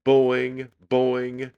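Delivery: high, loud, springy boings; yelled boings. A narrator's reading. Children teasing Susan over her curls, yelled. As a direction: chant it out, teasing.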